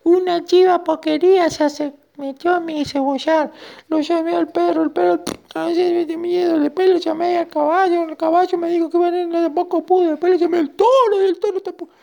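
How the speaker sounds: very high, squeaky gabble; complaining grandma. Acted. Grandma telling the ant about the goat, squeaky tearful gabble. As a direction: rattle off the woes in a high wail.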